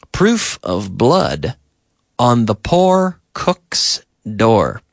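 slow, separated words for learners; slow model sentence. Shown as a demonstration. Teacher models example sentence for learners, slow and measured. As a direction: say it slowly, pausing midway.

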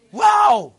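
sudden high, loud cry of amazement; a preacher bursting out wow at the congregation; excited wow. Real, not acted. Preacher amazed before the church, high loud wow.

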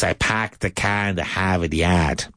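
dropped-R Boston accent, parked as pahked, yard as yahd; slow, broad Boston accent with no R sounds. Shown as a demonstration. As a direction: say it like a Kennedy-era Bostonian. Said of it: "But in this Boston accent".

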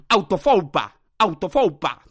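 loud, punchy bursts; shouted nonsense word. Real, not acted. Podcaster lets loose autofoupas like a madman, shouting. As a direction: fire the made-up word out loudly. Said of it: "sé que estoy gritando".

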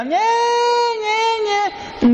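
put-on high, whiny baby wail; baby crying imitation. Priest imitating the crying baby on the plane, high whine. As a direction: mimic a fussing infant's cry.

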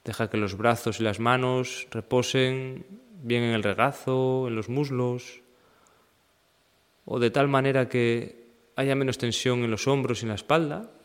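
even, unhurried meditation guide; guided meditation. Acted. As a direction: guide them through the body in level phrases with long pauses. Leads a seated meditation practice. (meditación guiada, pausada y serena)

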